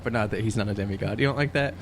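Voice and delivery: ordinary talk, nothing marked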